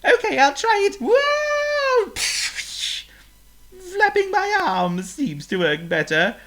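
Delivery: a bird having fun in the air; cheerful bird voice; happy flying bird. Acted. Chirp answers while flying.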